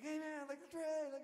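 small, high-pitched fan greeting; imitated excited fan. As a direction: mimic a fan calling from the crowd. Voices fans greeting them in crowd.

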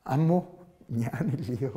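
low, hushed words to a weeping wife; soft hushed consolation; soft comforting. Retold or imitated. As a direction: murmur it gently, like a husband consoling. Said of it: "പപ്പ ഒരു വാചകം പറഞ്ഞു".

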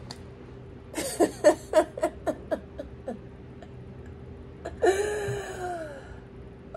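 long high laugh; big laugh at her own joke. Real, not acted. Laughs after taking them to church.

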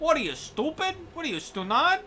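overly Italian-American insult comic; Don Rickles. Acted. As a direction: scold like a brash Italian comic. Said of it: "I made Don Rickles overly Italian".

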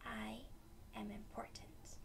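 "I am important" whispered softly. Shown as a demonstration. Teacher whispering affirmation to kids. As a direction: breathe it out quietly. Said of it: "Next one".

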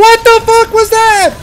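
loud, high shocked outburst; shocked yell. Real, not acted. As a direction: blurt it out in disbelief. Shocked by game event.

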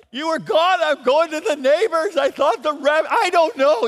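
put-on high, loud, tearful kid's voice; upset child. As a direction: blurt it out like a frightened boy near tears. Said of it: "tears well up in my eyes".

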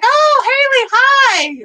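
very high, loud, drawn-out hello; exaggerated squeal of recognition; retold over-bright greeting. Retelling her greeting to friend's little sister, squealing.

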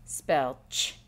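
clear, firm dictation prompt; teacher dictating. Shown as a demonstration. Teacher dictating a sound to students, clear and firm. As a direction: call out the sound for the class. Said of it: "say to the students".

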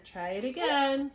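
ordinary talk

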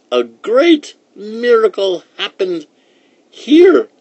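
put-on high, loud quavering old lady voice; high-pitched old-woman character voice. A narrator's reading. Old woman teaches magic words.

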